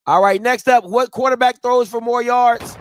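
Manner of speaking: announcer. Acted. Host announces next question.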